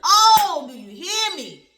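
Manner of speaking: loud, high burst; loud high excited cry to viewers. Real, not acted. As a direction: shout it out with joy.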